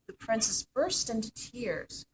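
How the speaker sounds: plain reading voice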